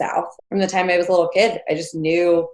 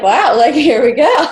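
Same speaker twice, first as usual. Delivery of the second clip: loud laughing gush, pitched up; 7 semitones higher, 10 dB louder than usual. Retold or imitated. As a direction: giggle the words out, thrilled and embarrassed. Excited over handsome new crush.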